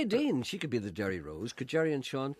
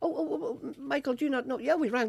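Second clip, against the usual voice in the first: squeaky high whine; high squeal; 14 semitones higher than usual. Acted. High-pitched protest at Michael.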